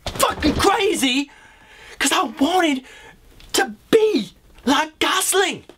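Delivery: high, stretched-out wail; mock crying, voice cracking high; a high, slow, wailing lament; whiny near-tears. Breaking down over Gosling.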